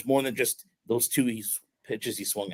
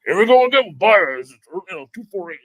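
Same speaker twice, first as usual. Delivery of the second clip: high, loud caricature of a griping host, easing off; exaggerated mock exasperation, starting loud and high; mock radio complainer; 7 semitones higher, 8 dB louder than usual. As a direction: parody the predictable sports-radio grumble. Mimics radio narrative about Baez.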